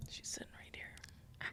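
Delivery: slow whispered aside. Real, not acted. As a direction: whisper it as a secret.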